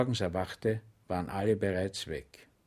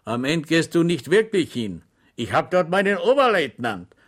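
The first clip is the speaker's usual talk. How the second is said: a deserter's higher, louder mocking voice; deserter teasing; 6 semitones higher, 7 dB louder than usual. Deserter mocking Schweig about his officer, higher and louder. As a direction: tease the soldier from the dark.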